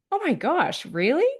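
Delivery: high-pitched, incredulous reaction; amazed disbelief. Retold or imitated. Rider reacting to coach's claim of precise control, amazed. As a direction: retell it in high-voiced astonishment.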